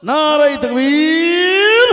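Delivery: loud, high cry with তাকবীর stretched long; rally the crowd at full voice; drawn-out rising shout on তাকবীর. Real, not acted. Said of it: "আরো জোরে".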